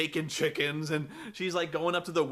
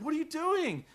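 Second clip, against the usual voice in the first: mock daughter; 11 semitones higher than usual. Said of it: "the daughter's like".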